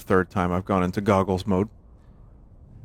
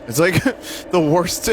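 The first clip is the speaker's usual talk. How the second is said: laughing; 8 semitones higher than usual. Real, not acted. Laughing at own joke.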